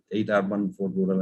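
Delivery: normal talking voice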